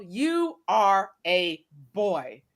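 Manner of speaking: slow, low stern put-down; stern quote. Retold or imitated. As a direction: deliver it slow and heavy. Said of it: "the words that the guy from Scandal".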